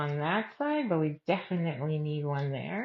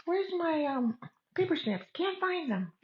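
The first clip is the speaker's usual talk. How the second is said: high, hammed-up lost voice; mock helpless crafter; 11 semitones higher than usual. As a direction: whine about the lost snips. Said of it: "I'm gonna be like".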